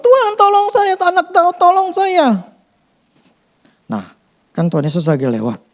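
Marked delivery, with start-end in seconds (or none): 0.0-2.4 much higher pleading call; retold cry for help. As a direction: cry out to the Lord, pitched high. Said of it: "Dia berteriak sama Tuhan".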